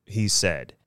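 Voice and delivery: ordinary delivery, nothing marked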